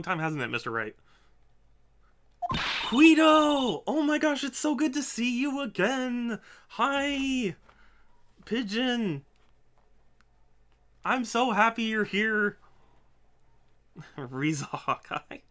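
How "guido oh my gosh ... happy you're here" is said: much higher and louder, gushing; overjoyed reunion. Acted. Character greeting a long-lost friend, gushing excitement. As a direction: burst out like seeing an old friend.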